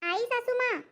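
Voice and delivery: high, dutiful daughter-in-law; sweet daughter-in-law. Acted. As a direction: sweetly answer the mother-in-law. Bahu answers.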